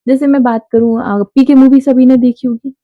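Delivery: ordinary delivery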